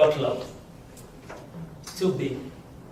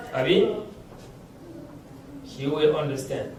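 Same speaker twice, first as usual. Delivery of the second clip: normal talking voice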